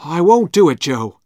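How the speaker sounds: stranger's voice. Acted. Stranger refuses Injun Joe.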